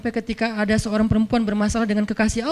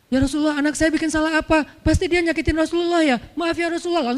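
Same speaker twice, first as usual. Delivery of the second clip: flustered apology; 6 semitones higher, 4 dB louder than usual. Acted.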